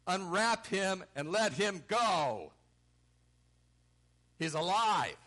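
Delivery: booming, victorious order; triumphant command. Acted. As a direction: proclaim it at full voice, drawing out the words. Voices Jesus commanding at tomb.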